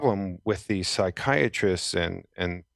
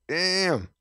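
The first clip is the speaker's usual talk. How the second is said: amazed damn; 12 semitones higher than usual. Retold or imitated. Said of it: "I was like".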